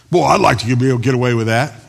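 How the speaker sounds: mock envious listener.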